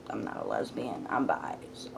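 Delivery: low, hushed self-correcting aside; whispered aside. Real, not acted. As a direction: drop to a near-whisper. Repeated aside correcting herself.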